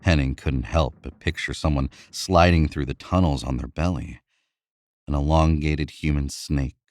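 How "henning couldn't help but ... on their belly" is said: ordinary delivery, nothing marked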